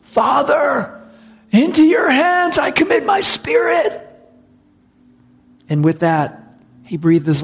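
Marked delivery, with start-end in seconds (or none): 0.0-4.0 high, loud, ringing cry to heaven. Acted. Jesus on the cross crying out to the Father, loud and high. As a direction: call it out at full voice, pitched way up. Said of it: "called out in a loud voice".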